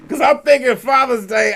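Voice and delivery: loud, high burst of excitement; excited shout. Real, not acted. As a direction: yell it like the day off is here. Excited about his day off.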